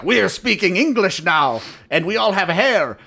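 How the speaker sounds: mock Klingon proclamation in halting English; old-Klingon accent. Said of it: "this broken accent like old Klingons".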